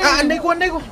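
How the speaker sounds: high, loud ouch; ouch cry. Acted. Friend pinched during gossip, high pained ouch. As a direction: complain of the pinch, high and hurt.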